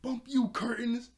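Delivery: high, loud playful attack cry; mock outburst. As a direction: imitate someone picking a fight, hammed up. Starfire attacking curtains, retold.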